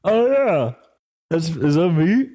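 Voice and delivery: high, slow dawning realization; acted realization. Stoned guy realizing the joke is about him. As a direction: play a stoned penny-drop moment.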